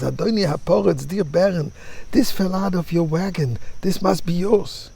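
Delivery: mildly acted breathless-errand plea; acted calling after someone. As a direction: hail the master in a high, urgent storyteller's voice. Runner calls after the baron.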